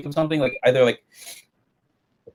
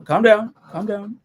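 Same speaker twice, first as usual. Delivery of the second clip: normal talking voice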